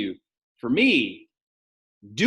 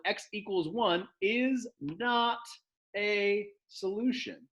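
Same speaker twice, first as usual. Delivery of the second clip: slow, deliberate; 8 semitones higher, 5 dB quieter, much slower than usual. Shown as a demonstration. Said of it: "you can say".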